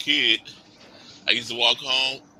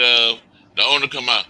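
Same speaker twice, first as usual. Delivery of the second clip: ordinary delivery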